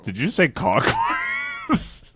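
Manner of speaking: high, slow, drawn-out question; mock-amazed clockwise; teasing disbelief. Real, not acted.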